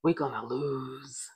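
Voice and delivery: slow, drawn-out whisper; stage whisper. A narrator's reading. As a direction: whisper it like a secret, stretching the last word. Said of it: "in the loudest whisper possible".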